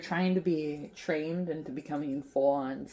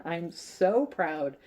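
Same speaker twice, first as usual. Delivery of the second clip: usual speaking voice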